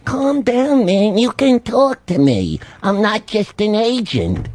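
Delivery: high, drawn-out imitation of a soothing agent; Dennis. Agent calming his crying client on the phone, high mock-earnest imitation. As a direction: mimic an agent pleading, pitched well up and stretched. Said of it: "He's going".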